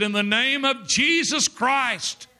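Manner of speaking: slow, high, commanding invocation; commanding rebuke. Retold or imitated. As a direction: rebuke the demons with authority. Preacher rebuking demons at bedside.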